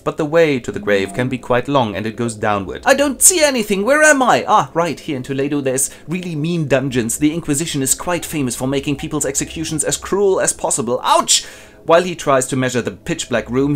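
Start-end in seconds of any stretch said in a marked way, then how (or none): 2.9-11.4 high, cartoonish prisoner voice; squeaky little man grumbling in the dungeon; prisoner groping in the dark. Prisoner voiced in comic retelling.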